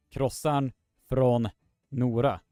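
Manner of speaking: krossan från Nora with Swedish r and long o in från; Swedish pronunciation. Shown as a demonstration. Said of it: "be sure to get the pronunciation correctly".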